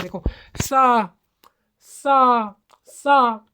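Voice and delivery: सा called out loud and slow, heavy breath behind it; demo aspirate. Shown as a demonstration. Teacher showing the breath in सा, slow and loud. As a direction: stretch out सा with a strong push of air. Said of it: "जैसे हम हा बोल रहे हैं".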